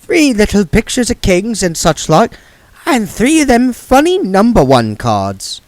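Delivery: high, lightly voiced old witch feigning innocence; Granny's voice. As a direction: play the sweet innocent old lady.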